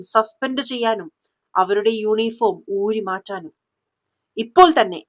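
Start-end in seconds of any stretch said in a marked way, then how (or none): 4.4-5.0 sharp order. A narrator's reading. SP orders suspension.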